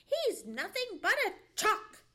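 high, loud scoff; scornful dog. A narrator's reading. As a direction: dismiss him with bluster. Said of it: "he said to himself".

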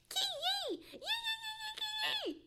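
very high puppy squeals; high kee-yee wails; hurt dog's yelping. A narrator's reading. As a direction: yelp in pain like a small dog. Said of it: "yelled the little dog".

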